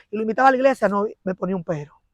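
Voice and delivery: ordinary talk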